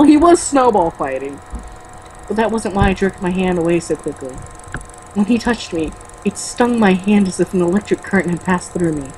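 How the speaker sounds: ordinary talk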